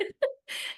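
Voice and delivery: airy voiceless giggle; a half-sob of laughter; breathy laugh. Real, not acted. Recalling the awkward reveal to her husband, breathy laugh.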